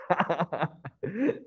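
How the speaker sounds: real burst of laughter; quick laugh at a small mishap. Real, not acted. Teacher to class after the board text vanished, laughing.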